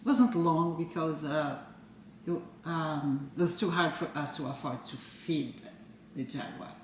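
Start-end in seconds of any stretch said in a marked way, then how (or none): none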